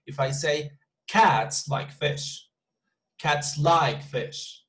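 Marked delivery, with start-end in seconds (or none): 1.1-2.5 very slow, Cats drawn out and stressed; Betonung auf CATS. Shown as a demonstration. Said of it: "on each one of these words". 3.2-4.6 very slow, like drawn out and stressed; Betonung auf LIKE. Shown as a demonstration. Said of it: "on each one of these words".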